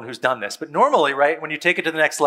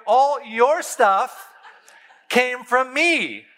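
higher, very slow, laughing threat; mock gangsta dad; 8 semitones higher than usual. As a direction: spell out who owns the stuff. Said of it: "gangsta with them a little bit".

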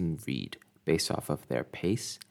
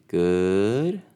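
"good." drawn out slowly; slow bored good; 2 semitones higher, 6 dB louder than usual. Shown as a demonstration. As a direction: stretch "good." like you're bored. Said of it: "a slower pace could signify boredom".

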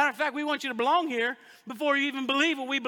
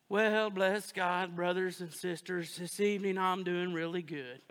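low, slow put-on pious voice; mocking churchgoers who greet the group in pious tones; churchy holy voice; 6 semitones lower, 4 dB quieter, slower than usual. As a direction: play the overly holy church guy. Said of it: "do you go to work and talk that way?".

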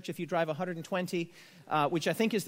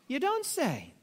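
dry mock surprise, higher pitch; sarcastic aside; 5 semitones higher, slower than usual. Preacher mocking the Bible's understatement, dry and sarcastic. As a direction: tease the text with irony.

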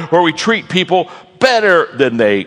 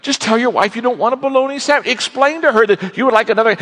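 high, mock-calming buddy voice; lightly voiced coworker calming him; soothing coworker; 10 semitones higher than usual. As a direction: talk him down like a pal. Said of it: "the guy beside him says".

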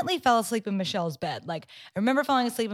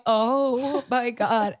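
drawn-out flustered; 2 semitones higher, much slower than usual. Retold or imitated. Flustered apology.

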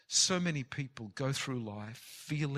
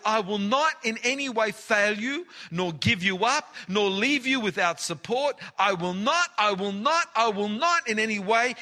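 preaching at full force; 9 semitones higher, 9 dB louder than usual. Acted. Preacher thundering God's promise to the congregation. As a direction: hammer every promise home. Reads verse again, preaching.

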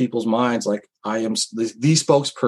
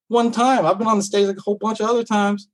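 pitched well up, protesting the unfair label; defending himself to the theater crowd, high and protesting; indignant retort; 9 semitones higher than usual. Retold or imitated. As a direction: push back, exasperated, higher. Retorting to audience members' claim.

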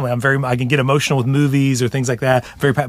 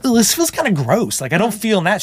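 awkward discomfort; 8 semitones higher than usual. Retold or imitated. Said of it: "So I would try that and be like".

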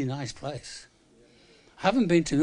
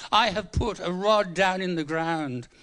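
ordinary talk

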